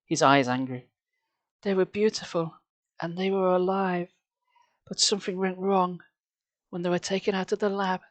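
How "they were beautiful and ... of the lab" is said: lightly voiced, hushed and bitter shopkeeper; a hint of a grieving maker, half whispered; understated sorrowful old store owner.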